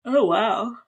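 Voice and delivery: mid-yawn. Real, not acted. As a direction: say it through a yawn. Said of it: "Are you yawning at me right now?".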